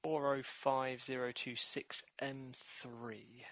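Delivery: slow, deliberate stardate readout; slow number readout; read out differently. Acted. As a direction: space every digit out slowly. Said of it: "you said it differently".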